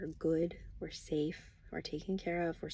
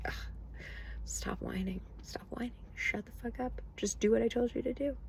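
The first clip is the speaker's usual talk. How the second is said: a hint of a hushed, fed-up scolding; impatient God; 4 semitones higher than usual. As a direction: tell them off under your breath. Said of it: "I would be like".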